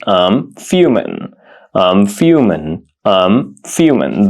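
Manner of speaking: slow model phrase. Shown as a demonstration. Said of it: "The word fume means".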